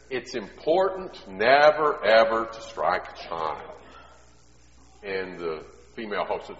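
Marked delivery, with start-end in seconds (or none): 0.1-3.8 slow, loud and deliberate, each word weighed; slow emphatic expert. Retold or imitated. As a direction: lay down the rule like a stern expert. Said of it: "and he says".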